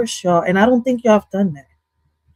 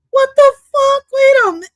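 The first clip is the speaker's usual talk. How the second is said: very high, loud sudden disbelief; shocked squeal; 4 dB louder than usual. Real, not acted. Streamer shrieks at [name]'s confession, high and loud.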